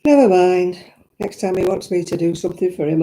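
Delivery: ordinary delivery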